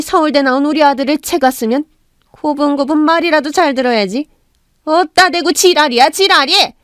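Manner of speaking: mother-in-law berates her daughter-in-law, rising to a shout; furious scolding. Acted. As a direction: tear into the daughter-in-law, ending in a yell.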